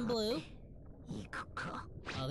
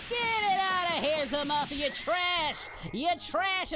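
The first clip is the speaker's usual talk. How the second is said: raised, higher-pitched taunting; hyped trash talk; 7 semitones higher, 5 dB louder than usual. Acted. Goku trash-talking Zamasu mid-fight, heated taunting shout. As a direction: hurl the insults loudly.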